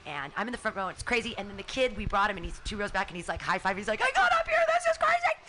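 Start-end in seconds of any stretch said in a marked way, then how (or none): 4.0-5.4 put-on excited kid. Kid high-fiving from two rows back.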